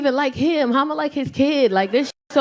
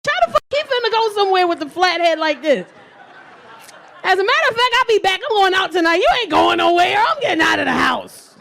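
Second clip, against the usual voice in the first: high-pitched comic protest; worked-up, incredulous outburst; indignant disbelief; 7 semitones higher, 3 dB louder than usual. Acted. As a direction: refuse loudly, voice pitched up. Acted disbelief, storming out on babysitting.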